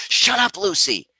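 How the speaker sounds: high, loud reenacted snap at the dog; acted-out exasperated shout; triggered yell. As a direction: yell it sharply, scolding a barking dog. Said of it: "I get triggered".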